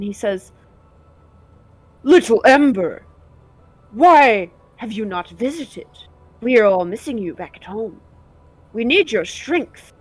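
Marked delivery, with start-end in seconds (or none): 2.0-9.9 a hint of wounded reproach from an elder; upset mentor. Game master as the lost mentor scolding Little Ember, upset. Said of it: "he looks upset with you".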